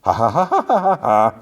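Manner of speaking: high, singsong cartoon cackle; imitated musical villain laugh; melodic laugh. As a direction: laugh up the scale, note by note. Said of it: "It was, like, melodically laughs".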